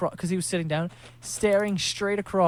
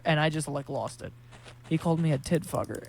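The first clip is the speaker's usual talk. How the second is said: ordinary delivery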